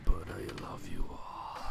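slow whispered affection. Pretend. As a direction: whisper it slowly to the audience. Whispered aside to viewers.

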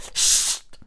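loud, long hissed "psst"; sharp shush. A narrator's reading. Vicky waves Konrad into silence, sharp shush. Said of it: "wedelte mit der Hand und machte".

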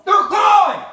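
high, loud shout; loud taunting cry. Real, not acted. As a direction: yell it to rub it in. Prankster taunts friend about shoes.

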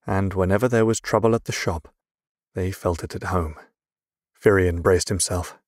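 ordinary delivery, nothing marked

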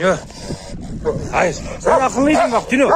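real exasperated scolding; pleading scold. Real, not acted. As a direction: remind the dog he was good. Scolding dog.

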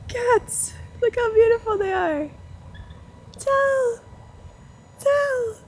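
ordinary talk, nothing marked

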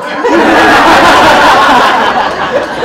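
big high laugh breaking out; loud burst of laughter. Real, not acted. Laughing out loud at his own story. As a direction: laugh hard at the joke.

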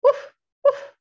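high, loud woofs; dog bark imitation. A narrator's reading. Family dog greeting Benny, high barking woofs. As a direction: bark like an excited little dog. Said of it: "said his dog".

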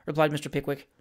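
plain reading voice

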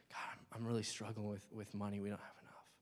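hushed, half-whispered confession; ashamed, timid prayer. Retold or imitated. A believer ashamed to ask God for money, hushed. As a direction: barely dare to say it to God. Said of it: "you have this shame and guilt and fear".

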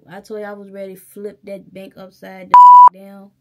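sudden yell mid-rant; furious shout; loud outburst. Real, not acted. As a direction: blow up with a real yell. Ranting about the bank.

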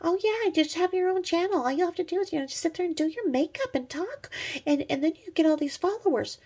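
high, airy mock voice; mocking people's advice. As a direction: parody breezy advice. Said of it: "people were like".

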